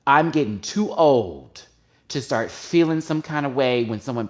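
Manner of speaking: real exasperation, loud and insistent; fed up. Real, not acted. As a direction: declare you've had enough, hammering each point. Fed-up rant about own distress.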